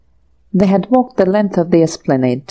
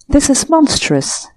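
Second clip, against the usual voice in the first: higher pitched indignant splutter; spluttering outrage; 8 semitones higher than usual. A narrator's reading. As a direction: choke on the insult. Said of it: "he spluttered".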